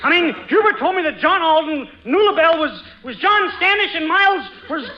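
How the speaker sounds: tangled, rushing to explain; nervous escalating muddle; flustered babbling. Acted.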